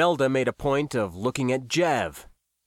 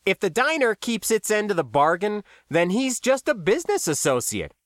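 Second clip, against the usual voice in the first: higher-pitched, breezy dismissal; dismissive; 8 semitones higher, 3 dB louder than usual. A narrator's reading. New Guy brushes off Max's warning, dismissive. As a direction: wave the worry off airily. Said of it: "New Guy waved this off dismissively".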